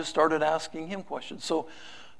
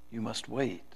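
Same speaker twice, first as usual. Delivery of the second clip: much softer, low and final; quiet reply; 6 semitones lower, 11 dB quieter than usual. Acted. God replying again to the pleader, quietly. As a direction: let it settle gently and firmly. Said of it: "As my master replied once again".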